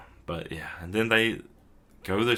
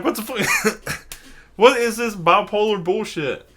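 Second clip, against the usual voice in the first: exasperated disbelief; 13 semitones higher, 7 dB louder than usual. Real, not acted. Speaker reacts in disbelief.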